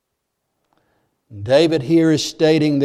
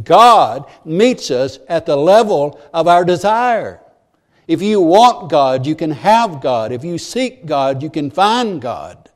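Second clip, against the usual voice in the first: slow, louder, rising preacher cadence; preaching; 3 dB louder, slower than usual. Acted. Preacher urging the congregation, slow and building. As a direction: press each promise home to the congregation.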